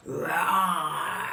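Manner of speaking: put-on a playful roar for the children; giant roar. Shown as a demonstration. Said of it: "Do a big roar like a giant would".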